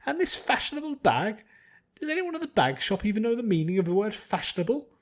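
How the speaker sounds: high, loud haughty complaining; looking down on every shop in town; snooty pretentious lady. A narrator's reading. Spoiled noblewoman griping to her servants, high and loud. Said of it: "a snooty, pretentious voice".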